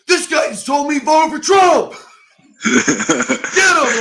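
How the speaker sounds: very high, loud shout through laughter; laughing scream. Retold or imitated. Luke accusing the reporter to the locker room, laughing shout. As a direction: yell it to the whole room, cracking up. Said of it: "like, scream".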